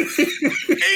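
high laugh in the posh voice; real laugh breaking through. Real, not acted. Speaker laughing at his own posh impression.

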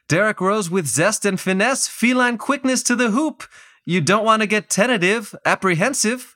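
high, lively rhyming commentator; Clyde Frazier impression. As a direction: ham up the rhyming broadcaster. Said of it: "Clyde said".

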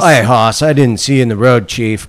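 loud put-on bro voice, sarcastic; mocking the driver. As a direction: ham up a clueless bro who hit you. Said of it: "if he said".

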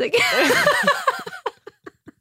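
high squealing laugh, burst out; real high laugh. Real, not acted. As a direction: shriek with laughter. Laughing at the accent attempt.

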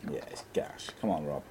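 unvoiced muttered aside; whispered aside. Real, not acted. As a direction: drop to a whisper. Aside muttered away from mic.

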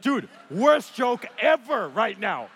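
loud, slow, punched-out disbelief; mock-outraged shout; exasperated retort. Nervous jumper scolding the instructor's bad joke, exasperated.

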